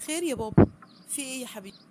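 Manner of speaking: loud, bright answer to father; eager loud reply. Acted. Daughter answering her father, loud and eager.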